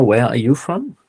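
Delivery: usual speaking voice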